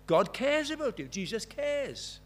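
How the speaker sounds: stressing God cares, slowed at the end; emphatic answer. Retold or imitated. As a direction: lean hard on it, then slow right down. Repeated answer, more emphatic.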